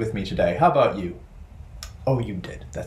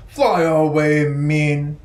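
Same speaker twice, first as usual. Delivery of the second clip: slightly louder mock-gruff; mock mean; 5 semitones higher, 6 dB louder, much slower than usual. Shown as a demonstration. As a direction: growl it playfully for kids. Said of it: "mean bird voiced".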